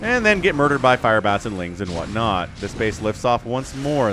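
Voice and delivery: ordinary talk, nothing marked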